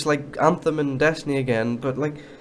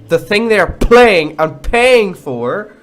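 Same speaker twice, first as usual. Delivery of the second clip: angry rant; 4 semitones higher, 5 dB louder, slower than usual. Real, not acted. Ranting about games' politics.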